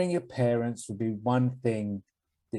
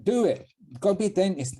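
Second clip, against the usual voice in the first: emphatic; 8 semitones higher, 3 dB louder than usual. Real, not acted. Advising teen, emphatic.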